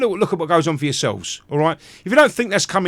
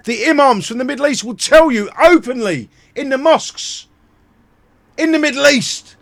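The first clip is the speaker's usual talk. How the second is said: louder, higher angry ranting; raised voice, furious at the courts; heated rant; 7 semitones higher, 3 dB louder than usual. Real, not acted. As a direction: hammer the point, building fury.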